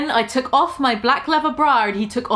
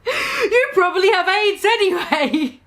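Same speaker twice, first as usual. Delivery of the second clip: mock shout, laughing; 7 semitones higher than usual. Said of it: "I shouted".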